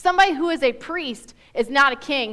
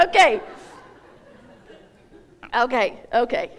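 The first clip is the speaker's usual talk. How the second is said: ordinary talk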